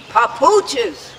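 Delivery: insistent repeat. Retold or imitated. Father repeats his demand to the attendant, insistent. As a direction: repeat it as an acted exclamation. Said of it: "my father would repeat".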